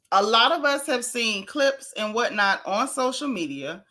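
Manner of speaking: ordinary talk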